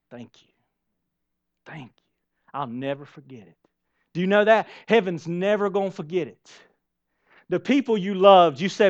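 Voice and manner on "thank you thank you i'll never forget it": soft thanks. Acted. Heaven's tender thanks, very soft.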